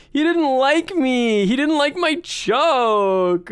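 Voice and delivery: high, drawn-out mock whine; whiny complaint. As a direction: imitate a wailing, wounded coworker. Retold coworker complains to speaker.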